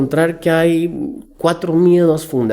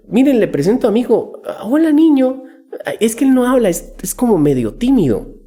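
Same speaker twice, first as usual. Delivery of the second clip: parent introducing son; 7 semitones higher than usual. Retold or imitated. Parent introducing shy child.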